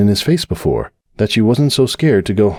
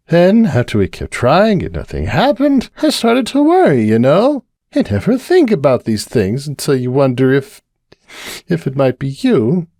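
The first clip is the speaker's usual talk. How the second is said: high, tipsy, worried mare voice; Cupcake confiding; 8 semitones higher than usual. Acted. Cupcake confiding her fears to the bartender, high and worried. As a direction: pour out the worry, a little tipsy.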